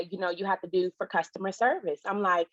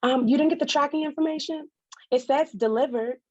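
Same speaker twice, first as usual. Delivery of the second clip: owner's reply; 6 semitones higher than usual. Retold or imitated. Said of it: "owner quoted on phone".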